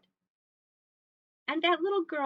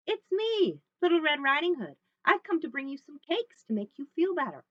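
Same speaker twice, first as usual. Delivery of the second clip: put-on higher, louder little girl voice; Little Red; 7 semitones higher, 7 dB louder than usual. Little Red announces herself at Grandma's, high and lively. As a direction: call brightly through the door.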